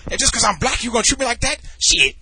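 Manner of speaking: high, loud, indignant outburst; pissed off. Retold or imitated. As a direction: imitate a stranger angrily taking offence. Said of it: "He starts getting all pissed off and stuff".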